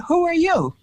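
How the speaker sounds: high mock disbelief; incredulous retort. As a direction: imitate the surprised producers. Producers' dismissive retort retold.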